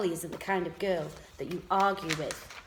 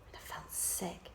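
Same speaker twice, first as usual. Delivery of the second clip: whispering; 14 dB quieter than usual. A narrator's reading. Scared narrator whispering.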